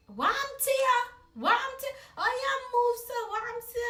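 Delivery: high, loud Jamaican mom questioning. Retold or imitated. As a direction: demand an answer like a suspicious mom. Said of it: "my mom was like".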